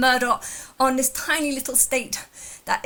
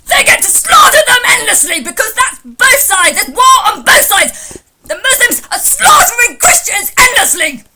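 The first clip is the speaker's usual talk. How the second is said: parody rant; 11 semitones higher, 15 dB louder than usual. Retold or imitated. Mock rant back at caller.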